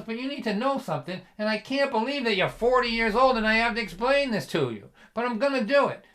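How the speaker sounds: high, loud, indignant lecture; exasperated father. Retold or imitated. Father explaining to his forty-year-old son, exasperated. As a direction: scold as a fed-up dad.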